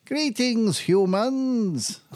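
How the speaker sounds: high robotic alien greeting; alien frontman voice. Retold or imitated. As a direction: greet the humans like a stage alien. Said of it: "he's like".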